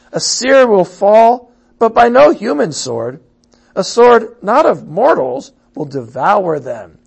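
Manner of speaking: loud, high proclaiming of the prophecy; forceful scripture reading. Acted. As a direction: cry it out to the people. Reads prophecy at full force.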